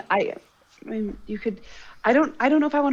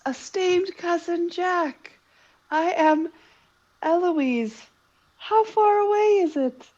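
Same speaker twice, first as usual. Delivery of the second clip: high, drawn-out put-on voice; sing-song, overdone and high; exaggerated cartoon intonation; 7 semitones higher, slower than usual. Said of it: "Why are you talking like that?".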